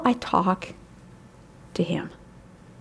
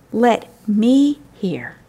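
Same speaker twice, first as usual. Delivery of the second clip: slow; much slower than usual. Acted. The bridegroom's tender request.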